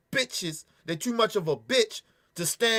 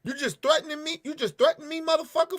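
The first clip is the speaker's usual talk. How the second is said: higher, incredulous challenge; retorting to officer; 8 semitones higher than usual. Retold or imitated. Answering the officer in the video, high and incredulous. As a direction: throw it back at the cop. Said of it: "who the fuck you think you talking to".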